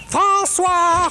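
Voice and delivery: high, loud shouted call; shouting. Acted. Casimir shouting for François to come out, high loud shout. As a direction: yell his name across the island. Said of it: "tu pourrais pas crier un petit peu moins fort".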